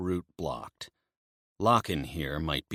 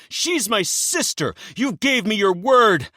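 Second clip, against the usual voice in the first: roaring; 9 dB louder than usual. Acted. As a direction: roar it with betrayed rage. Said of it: "Emil roars".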